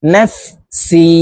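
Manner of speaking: নফসি said very slowly, syllables stretched; slow drawn-out recitation. Shown as a demonstration. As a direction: stretch নফসি out as a model for students. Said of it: "আপনারা আমার সঙ্গে বলার জন্য চেষ্টা করুন". (খুব ধীরে টেনে পড়া)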